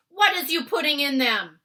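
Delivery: loud high demanding yell; shouting. Acted. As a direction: bark the question. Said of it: "he shouted".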